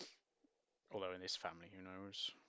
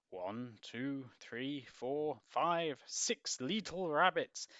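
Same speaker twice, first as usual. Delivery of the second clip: counting, getting louder and higher, slowing at the end; 5 semitones higher, 8 dB louder, much slower than usual. Acted. Farmer counting sleeping rabbits, from plain to loud and slow. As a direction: build each number up to a big slow finish.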